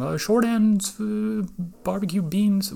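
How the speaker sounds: high, drawn-out ordering voice; customer ordering. Retold or imitated. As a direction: call out the order, hesitating and high. Acting customer calling out an order.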